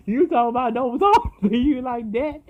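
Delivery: high, loud laughing disbelief; cackling mockery. Real, not acted. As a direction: talk through the laughter. Mocking the mother while laughing.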